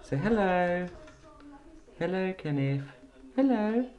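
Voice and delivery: high sing-song hello for mimicking; prompting a pet. Real, not acted. Owner teaching Kenneth the parrot to say hello, high pet talk. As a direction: model the word up high for a bird.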